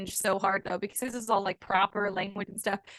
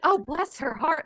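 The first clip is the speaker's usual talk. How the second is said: genuine pity; 6 semitones higher, 4 dB louder, slower than usual. Retold or imitated. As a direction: say it with real sympathy for them. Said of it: "They'd be like".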